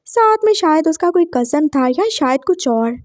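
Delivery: plain reading voice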